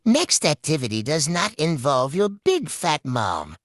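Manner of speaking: ordinary talk, nothing marked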